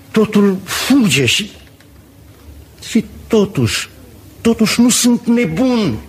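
high-pitched, anguished confession; rising panic. Acted. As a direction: pour out the dread in a high, urgent voice. Confessing moments of terror, losing grip.

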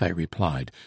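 ordinary delivery

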